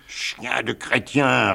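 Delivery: slow, scornful insult; galley master. Acted. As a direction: spit out the insult slowly. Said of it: "répondit le Levanti patron".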